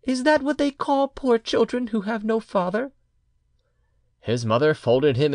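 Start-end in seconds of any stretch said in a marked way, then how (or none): 0.0-2.9 put-on high-pitched boy's question; half-frightened child. A narrator's reading. Child asks mother about fatherless children, half frightened. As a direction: ask in a small boy's high voice. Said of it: "half frightened by her anger".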